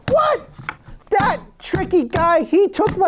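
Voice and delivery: put-on high, indignant old father; mock-outraged father; father's voice. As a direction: squeal it out as the cheated dad. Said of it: "He's like".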